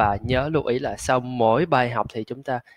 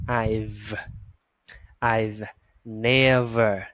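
slow demo; much slower than usual. Shown as a demonstration. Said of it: "Phụ âm thì chúng ta chỉ".

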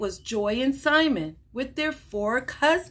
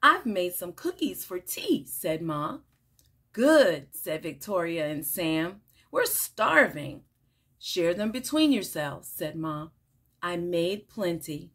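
animated picture-book read-aloud with lively character lines; reading to children; 4 semitones lower than usual. Acted. Teacher reading a picture book to children, bright and animated. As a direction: read it like story time for little kids.

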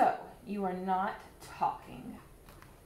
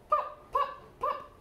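popping sounds. Acted. Bubbles popping.

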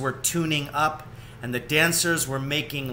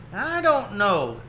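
slow, higher hesitant friend; a lightly voiced worried friend; doubtful Esther; 7 semitones higher, slower than usual. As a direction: weigh the risk aloud.

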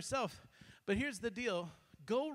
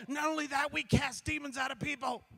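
excited disciples; 5 semitones higher than usual. Retold or imitated. Disciples rushing back excited.

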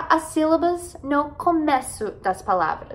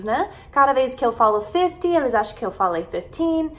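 normal talking voice

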